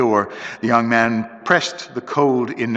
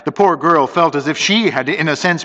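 ordinary delivery, nothing marked

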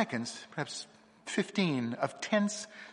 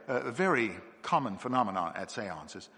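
usual speaking voice

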